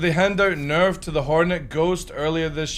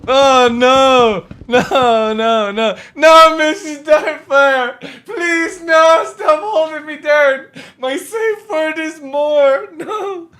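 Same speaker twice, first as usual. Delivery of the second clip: mock panic; 13 semitones higher, 9 dB louder, much slower than usual. Pretending to be held down.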